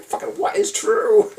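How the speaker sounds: high, loud desperate shout; desperate high cry. Retold or imitated. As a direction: wail the question out at the top of the voice. Retold desperate plea on trip.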